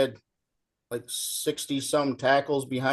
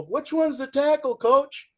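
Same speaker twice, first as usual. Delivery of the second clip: clueless question; 11 semitones higher than usual. Retold or imitated. Player asks coach after botched play.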